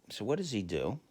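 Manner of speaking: ordinary talk, nothing marked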